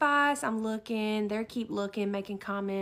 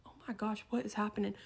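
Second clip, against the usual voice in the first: soft, hushed self-talk; bewildered aside; 2 semitones lower, 9 dB quieter, much faster than usual. Retold or imitated. Shopper alone hearing screams, quiet bewildered aside. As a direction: say it under your breath, confused.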